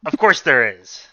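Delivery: loud, emphatic announcer line; booming ad read. Pretend. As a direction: punch it like a commercial. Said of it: "now I can do my ad read".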